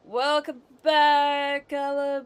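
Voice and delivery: slow, loud welcome call; loud greeting. Real, not acted. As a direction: call it out warmly and slowly. Greeting returning chat member.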